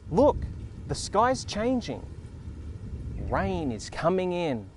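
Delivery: put-on slow, sing-song reading for small children; picture book reading. Reading Thank You Rain aloud to young viewers, gentle storytelling. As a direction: read it out like story time, savouring each word.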